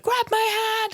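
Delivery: very high squeaky plea; mock urgent plea. Sarah Jane reaching for the falling girl, high mock plea.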